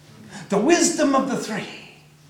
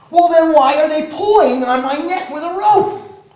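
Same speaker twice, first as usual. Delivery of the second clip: loud, higher outraged question; indignant cry; 8 semitones higher, 9 dB louder than usual. Acted. The cow crying out to the sage, loud and indignant. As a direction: protest as you're dragged away. Cow protests being dragged.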